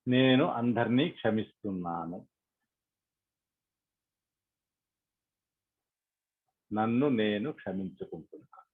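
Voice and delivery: measured affirmations with long silences; guided meditation prayer. Acted. Leader voicing affirmations for meditators to repeat, calm and prayerful. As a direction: lead them phrase by phrase, leaving space to repeat.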